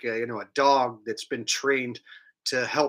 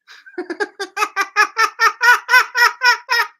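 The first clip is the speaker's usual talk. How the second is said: loud laugh; 10 dB louder than usual. Real, not acted. Laughs at own joke.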